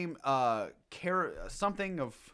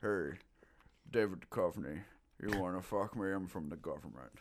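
creepy villain voice; 10 semitones lower, 8 dB quieter than usual. Retold or imitated. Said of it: "And he's like".

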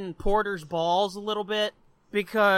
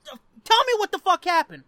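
louder, higher, exasperated outburst; pissed-off demand; 8 semitones higher, 6 dB louder, faster than usual. Acted. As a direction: voice a fed-up reader demanding answers. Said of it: "It's got you fucking pissed".

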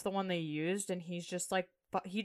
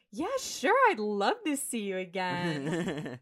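imitated phone sweetheart; 3 dB louder, slower than usual. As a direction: mimic her gushing to him on the phone. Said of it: "the way she talks to him".

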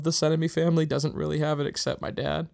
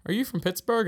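curious lady; 8 semitones higher, 3 dB louder than usual. Retold or imitated. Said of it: "And a lady went".

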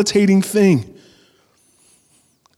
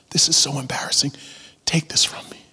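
half-whispered private plea; soft, confiding whisper to God; hushed prayer; 2 semitones lower, 6 dB quieter, faster than usual. Acted. Believer begging God to take away an embarrassing sin, hushed.

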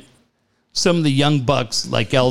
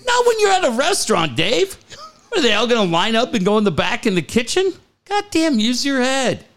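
much higher, worked-up scolding; higher, huffy mock exasperation; exasperated rant; 9 semitones higher than usual. Real, not acted. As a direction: scold him in a comic huff.

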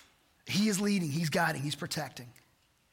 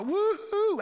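high gleeful woo-hoo, imitating celebrating; mock whoop; 14 semitones higher, 5 dB louder than usual. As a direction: whoop it up like a lottery winner. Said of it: "I'd woot and holler".

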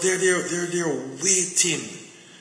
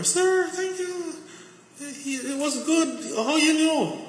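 much higher, slow, delighted woman's voice; grateful lady's voice; 14 semitones higher, slower than usual. Retold or imitated. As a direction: lift it way up and stretch it, thankful and amazed. Voicing the grateful coworker lady.

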